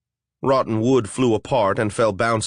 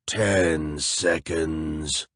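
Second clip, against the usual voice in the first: lower, very slow monster voice; wet monster voice; 6 semitones lower, 3 dB quieter, much slower than usual. Acted. A flesh golem setting a riddle for intruders, slow and lower. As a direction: drag each word out like a lumbering golem.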